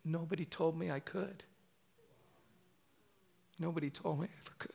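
soft, hushed, crying reply; imitated tearful voice. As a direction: retell it as if holding back tears. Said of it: "I hear Deb crying".

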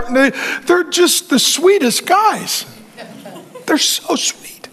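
mock cooing. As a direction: coo over them like puppies, mocking. Mocks overly nice men.

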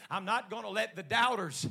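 building from firm, loud preaching to a full-force shout; rising pulpit crescendo. Real, not acted.

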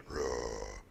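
low spoken roar, an imitated growl; mock roar. Preacher imitating his colleague's roar mid-sermon. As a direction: give a deep playful roar. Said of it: "he's gotta do the".

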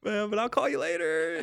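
high, fast whiny protest; high put-on voice, apologetic; mock-whiny excuse to a friend; imitating himself. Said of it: "I was like".

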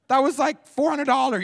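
very high, very loud outraged cry; hammed-up parental outrage; aghast shriek. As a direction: shriek like a parent watching money get smashed. Parent aghast at smashed gift.

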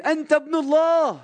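high, loud exclaiming shout of faith; cry out. Retold or imitated. Nathanael cries out to Jesus in a loud, high shout. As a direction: burst out in awe. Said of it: "صرخ".

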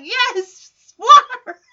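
high, loud gleeful cheer; mock delight at the sprinklers. Girls drenched by sprinklers, gleeful cheer. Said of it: "they are having a blast".

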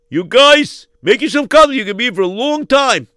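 sneering, mocking host; sarcastic hospitality; mocking gloat. Gloating kidnapper.

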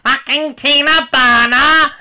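high, slow, loud croaking villain voice; a dying cartoon villain; dying Skeletor. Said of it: "[name], please do your Skeletor impression".

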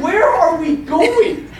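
high, slow comic exasperation; exasperated demand. Retold or imitated. Injured patient to oblivious orderly, slow exasperated demand. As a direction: drag out the question in mock outrage.

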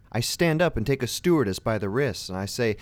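usual speaking voice

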